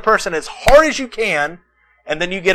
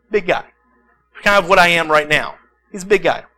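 usual speaking voice

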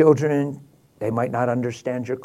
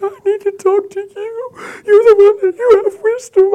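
very high, louder, sobbing woman's voice; desperate crying lady; 8 dB louder than usual. Retold or imitated. Distraught woman pleading with the pastor after driving all night, high sobbing. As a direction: wail it like a stranger who drove all night.

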